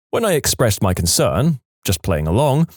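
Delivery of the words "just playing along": plain reading voice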